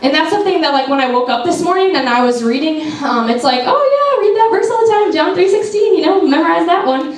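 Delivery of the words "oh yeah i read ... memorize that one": high, breezy, dismissive self-mockery; an airy, much higher put-on voice of her careless younger self; mock blasé. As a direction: brush it off like a know-it-all teen. Mocking her own casual attitude.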